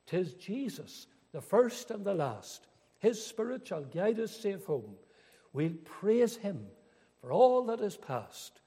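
rising, swelling recital of a hymn; fervent hymn recital. Acted. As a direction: lift the hymn words to praise. Reciting hymn verse with fervour.

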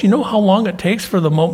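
ordinary talk, nothing marked

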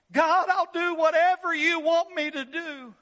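high, loud bargaining with God; stranded man promising God anything if saved; pleading shout. Acted. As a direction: bargain desperately, calling up into the dark. Man hanging from cliff root.

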